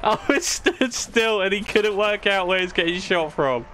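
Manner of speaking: high, helpless laughing speech; laughing through words. Real, not acted. Telling a friend how the enemy was clueless, laughing. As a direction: tell it through a fit of laughter.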